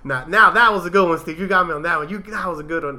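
mock the kid cracking up at the joke; lightly voiced young coworker; imitating the younger coworker. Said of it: "starts messing up at that one".

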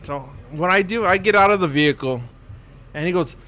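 usual speaking voice

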